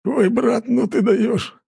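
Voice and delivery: high, amused, still giggling; laughing. Acted. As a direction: slap his shoulder laughing. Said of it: "расхохотался". (со смехом)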